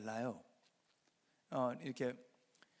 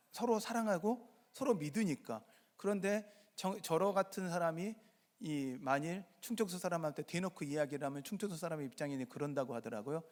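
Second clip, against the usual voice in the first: ordinary delivery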